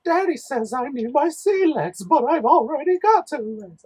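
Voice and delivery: put-on piping little child voice. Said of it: "I wanna hear what he says".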